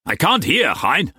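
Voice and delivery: high, exasperated protest; irritated complaint. A narrator's reading. Mauss interrupting his translation to complain, irritated. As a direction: pitch it up, straining to listen.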